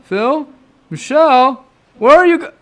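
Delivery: calling out. Acted. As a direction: call after missing friends. Searching for lost friends.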